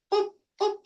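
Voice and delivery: high squeaky boop boop sound effect; playful boops. Pretend. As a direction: chirp the boops like a cartoon pop. Playful sound while moving exponent.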